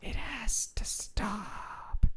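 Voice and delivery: very slow ASMR whisper. Pretend. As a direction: draw it out in a whisper. ASMR whisper game continues.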